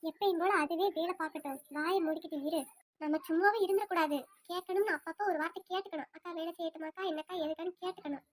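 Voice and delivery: daughter-in-law grumbling quietly to the other; hushed aside. Acted. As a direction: mutter so the sister-in-law won't hear.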